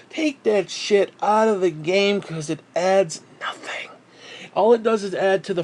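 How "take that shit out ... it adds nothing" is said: slow, voiceless whisper. Real, not acted. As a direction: hiss it out slowly under your breath. Rant drops to a hiss.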